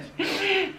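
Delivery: high breathy laugh; tired laugh. Real, not acted. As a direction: laugh it off, worn out. Laugh after saying he is exhausted.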